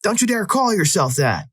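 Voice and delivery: high-pitched scolding yell, volume held back; acted indignant cry, high and sustained; mock yelling. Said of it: "so he's yelling at me".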